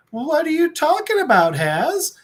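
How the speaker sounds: high-pitched feigned innocence; playing dumb. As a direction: act like you have no idea. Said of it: "she's just trying to play dumb".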